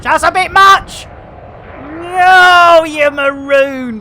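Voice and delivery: high loud shocked protest; alarmed outburst. Real, not acted. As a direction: yell it as the ship takes damage. Getting hit in combat.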